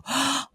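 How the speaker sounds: soft amazed intake of breath; toddler gasp. Retold or imitated. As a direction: gasp in wonder like a little girl. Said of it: "she'd go".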